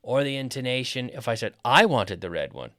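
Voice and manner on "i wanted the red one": I punched loud, the rest falling; loud contrastive I; stress on I. Shown as a demonstration. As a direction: hit I hard, as in me and nobody else. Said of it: "not someone else".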